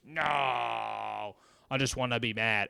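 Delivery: slow, stubborn mock pout; mock sulky grumble; sulky refusal. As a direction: drag it out like a grumpy kid. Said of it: "what are they honestly gonna say".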